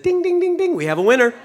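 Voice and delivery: high, loud game-show announcer ding; loud laughing game-show call; game-show buzzer. Acted. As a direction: ring it out like a quiz host crowning a winner. Game-show winner bell quote.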